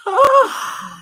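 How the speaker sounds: a sharp high gasp; shocked gasp. Real, not acted. Shocked reaction.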